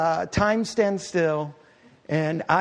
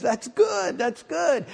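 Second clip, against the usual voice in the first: higher, lip-smacking master chef praising his dish; 8 semitones higher, slower than usual. Retold or imitated. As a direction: kiss your fingers and savour it like a chef. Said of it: "he kisses his fingers, right? And he says".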